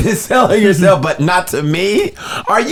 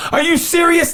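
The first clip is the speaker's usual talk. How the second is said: high disbelieving "are you serious"; incredulous; 11 semitones higher than usual. Retold or imitated. Rejected man asking the women in disbelief. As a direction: pitch it way up in disbelief. Outraged at being refused.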